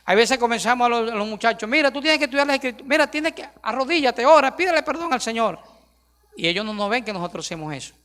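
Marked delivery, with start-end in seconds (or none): 1.7-5.6 put-on higher, quick, bossy lecturing of the boys; nagging parent. Pastor mimics himself ordering his sons around, bossy and quick. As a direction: rattle off orders like a preachy father.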